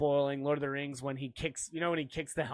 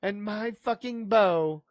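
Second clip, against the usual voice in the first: higher, slow mock-heroic pledge; Legolas; 4 semitones higher, 4 dB louder than usual. Joker badly imitating the archer's pledge, slow and hammed-up. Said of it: "Legolas didn't say it like that".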